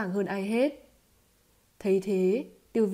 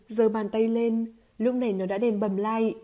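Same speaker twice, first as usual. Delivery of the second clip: ordinary delivery, nothing marked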